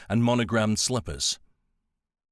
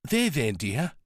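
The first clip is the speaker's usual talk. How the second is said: put-on calming an upset young woman; soothing; 4 semitones higher than usual. A narrator's reading. Nancy soothing Melanie.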